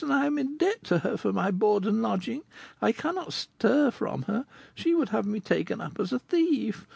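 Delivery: put-on high, girlish voice telling her sad tale; young girl Goualeuse. Goualeuse tells Rodolphe her story, in a high girlish voice. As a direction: speak as the poor young girl recalling her past.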